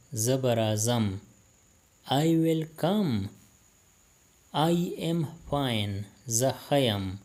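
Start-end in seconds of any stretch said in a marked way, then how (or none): 2.1-3.3 I will come, drawn out slowly, a bit higher; slow, careful repeat. Shown as a demonstration. Teacher repeats phrase for Pashto learners, slow and clear. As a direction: spell it out slowly so learners can copy.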